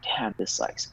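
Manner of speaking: a near-whispered grumble to himself; muttered complaint. Retold or imitated. A dieter grumbling to himself about the workouts, muttered. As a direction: mutter it under your breath, fed up. Retold inner grumble while dieting.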